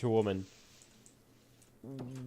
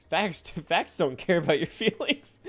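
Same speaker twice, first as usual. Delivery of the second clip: higher, laughing delivery; 4 semitones higher, 3 dB louder than usual. Real, not acted. Streamer teasing with a known slogan, laughing. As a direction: say it through real laughter.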